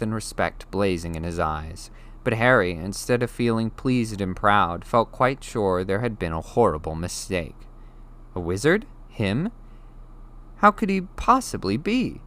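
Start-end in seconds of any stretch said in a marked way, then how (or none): none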